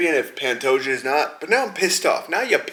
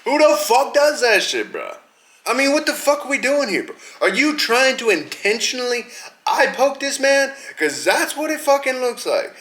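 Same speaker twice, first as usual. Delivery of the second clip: outraged yelling; 9 semitones higher than usual. Real, not acted. Outraged rant about eye pokes.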